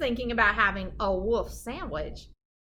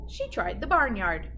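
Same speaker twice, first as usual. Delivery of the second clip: plain reading voice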